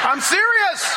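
high, laughing insistence; giggling while swearing it's true. Real, not acted. Laughing at the poison memory.

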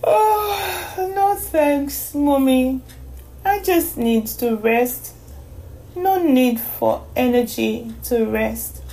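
put-on slow, drowsy girl voice; worn out, turning down juice gently; sleepy. A narrator's reading. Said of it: "Donome yawned".

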